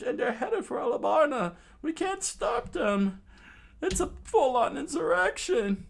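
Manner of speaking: high, fast, loud alarmed report; frantic messenger. Acted. Messenger warning the captain of revolt, high and rushed. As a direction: rattle off the disaster in a squeaky panic.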